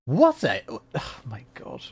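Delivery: normal talking voice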